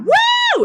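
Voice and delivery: high, loud "woo"; screaming. Retold or imitated. Woman voices her loud signs, screaming. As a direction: whoop it out like a siren. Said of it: "It's like screaming".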